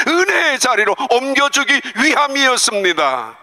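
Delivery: fervent, lifted preaching; preaching at full force. Acted. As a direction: build the sermon to its peak. Preaching climax.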